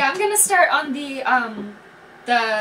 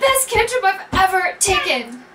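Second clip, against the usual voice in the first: high, loud delight; excited outburst; 6 semitones higher, 5 dB louder than usual. Real, not acted. Player thrilled with her guidance cantrip, excited outburst. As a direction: crow about it at the top of your pitch.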